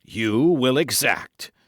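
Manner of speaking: "You will exact" slow and loud, each syllable dwelt on; astonished syllable-by-syllable stress. A narrator's reading. Buckingham repeats "You will exact" to Felton, slow astonished emphasis. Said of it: "dwelling upon each syllable of the three words".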